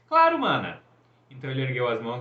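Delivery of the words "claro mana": eager agreement. A narrator's reading. Apollo eagerly agrees to help.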